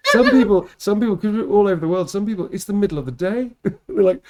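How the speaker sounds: giggling through fast words; laughing. Real, not acted. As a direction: laugh while you explain. Laughing at viewers yawning.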